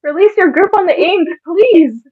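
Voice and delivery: high, urgent begging; desperate plea. Acted. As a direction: plead to be released. Pleading to be let go.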